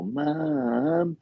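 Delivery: put-on high little-child voice calling Dad; childlike plea. The lady wheedling her dad, high and childlike. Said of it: "she used to change her voice".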